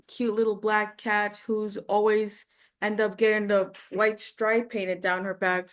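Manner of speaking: ordinary delivery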